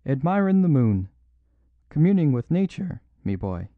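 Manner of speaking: defiant Irish brogue, high and loud; pure Irish. A narrator's reading. Officer mocking Valentin's question, loud Irish brogue. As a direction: toss it off in a broad Irish lilt. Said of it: "he cried in pure Irish".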